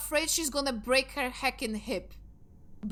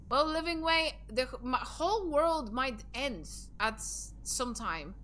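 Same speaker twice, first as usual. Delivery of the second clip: usual speaking voice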